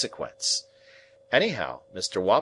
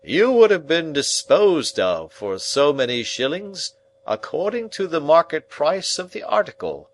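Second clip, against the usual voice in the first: louder, much higher telling; pompous uncle, relishing; 5 semitones higher, 6 dB louder than usual. Acted. Uncle frightening the boy with the butcher story, loud and high. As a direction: paint the butcher scene with gusto. Pumblechook's grim butcher tale.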